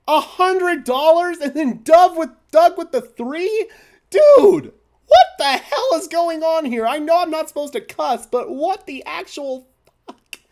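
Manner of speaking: building from a loud no-no-no to high, loud shouting; shocked streamer shouting at a surprise donation; stunned disbelief. Real, not acted.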